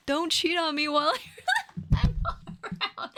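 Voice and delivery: real laughter breaking up the line; words broken by high laughing. Real, not acted. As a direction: giggle through the words. Laughing while reading line.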